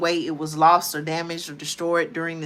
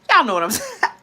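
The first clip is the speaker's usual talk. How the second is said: laughing through y'all know what I'm saying, high and loud; laughing at herself; 9 semitones higher, 6 dB louder than usual. Real, not acted. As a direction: break into a laugh at her own slip. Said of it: "I had to laugh at myself".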